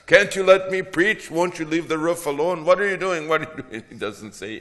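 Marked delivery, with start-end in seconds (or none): 0.1-2.6 shouted high and loud with a laugh; outraged heckler shouting; angry crowd shout. Acted. Scribes scolding Jesus about roof. 2.6-3.3 loud and high, all weight on WHAT; full-volume outraged demand; indignant shout. Acted. Crowd scolding, repeated. 3.3-3.8 a calmer echo of the outraged shout. Acted. Crowd scolding, repeated.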